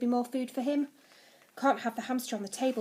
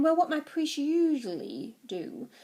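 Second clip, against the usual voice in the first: slow, higher, happily explaining; delighted; 4 semitones higher, 4 dB louder, slower than usual. A narrator's reading. Zeus recalls his priests' old sacrifices, delighted. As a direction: explain with relish, all smiles. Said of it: "all smiles again".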